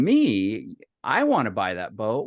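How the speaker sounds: mock disbelief, ending on a rise; incredulous echo. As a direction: throw it back as a rising question. Echoes the suggestion in disbelief.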